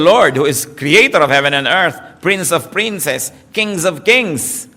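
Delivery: slow solemn praise. Real, not acted. Praising the Lord.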